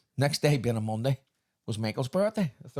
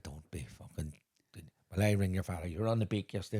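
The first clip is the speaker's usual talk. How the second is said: stern brother; 6 semitones lower, 6 dB quieter than usual. Retold or imitated. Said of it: "he said".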